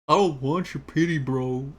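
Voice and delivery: casual, blunt take; bro delivery. Shown as a demonstration. Giving up on girly, says it like a dude. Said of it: "somewhat girly".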